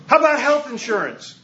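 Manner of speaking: a worried priest pressing the point; anxious clergy. Retold or imitated. Clergy quoted asking about insurance.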